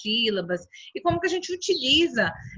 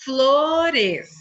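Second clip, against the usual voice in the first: FLORES stretched loudly as flo and res; emphatic, drawn-out "flores"; syllable by syllable; 3 semitones higher, 6 dB louder than usual. Shown as a demonstration. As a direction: say "flores" loud, drawing out each syllable. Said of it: "vão sempre acompanhadas de uma vogal".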